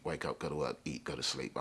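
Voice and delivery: ordinary delivery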